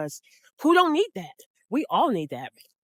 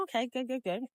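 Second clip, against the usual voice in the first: quiet approval; 3 semitones higher, 11 dB quieter, faster than usual. Retold or imitated. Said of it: "gave her some eye contact".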